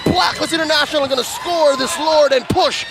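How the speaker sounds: fast, excited play-by-play call; esports caster. Acted. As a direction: call the fight rising to a peak. Live play-by-play at full force.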